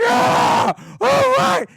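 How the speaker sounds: very high, loud mock cheering; crowd going apeshit. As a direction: scream like golf fans losing it. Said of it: "Then they all go apeshit".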